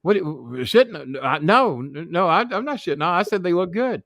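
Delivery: stammering, high-pitched mock panic; scared denial. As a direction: imitate a frightened man backpedalling. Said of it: "scared to fucking death".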